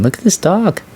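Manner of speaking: high, excited gushing. Real, not acted. Streamer gushing over a dog, high and excited.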